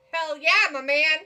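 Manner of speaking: loud, hyped hell yeah; excited cheer. Real, not acted. Cheering at the screen, loud and hyped.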